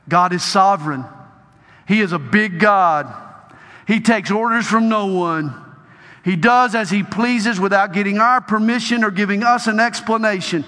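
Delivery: loud, high preaching at full force; forceful preaching. Acted. Preacher exhorting the crowd, loud fervent preaching. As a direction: drive it home to the congregation.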